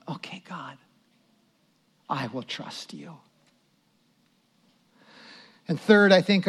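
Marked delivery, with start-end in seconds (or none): 0.1-3.2 hushed prayer, starting in a whisper; whispered surrender. Acted. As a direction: whisper it to God, then barely voice it. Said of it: "this attitude of going".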